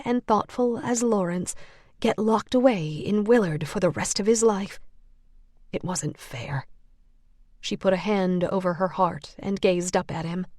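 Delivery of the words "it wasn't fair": whispered, much softer; whispered thought. A narrator's reading. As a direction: breathe it out in sorrow. Clara's sorrowful inner thought.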